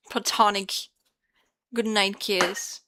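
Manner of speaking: usual speaking voice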